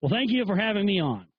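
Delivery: lightly voiced male guest; a hint of a polite guest impression; imitated radio guest. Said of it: "And then the guest goes".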